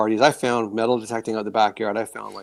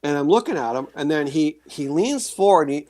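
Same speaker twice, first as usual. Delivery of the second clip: normal talking voice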